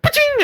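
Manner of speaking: high, sharp pa-ching of a bolt on chainmail; metallic ping. Acted. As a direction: voice the ricochet off armor. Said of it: "you hear".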